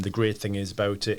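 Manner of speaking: ordinary talk, nothing marked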